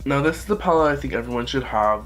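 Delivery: usual speaking voice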